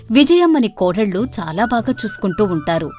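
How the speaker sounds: ordinary delivery, nothing marked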